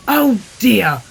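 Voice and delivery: loud, high exclamation of dismay; drawn, high "oh dear"; dismayed Percy. A narrator's reading. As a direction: cry out at a mishap. Percy bumps the buffers.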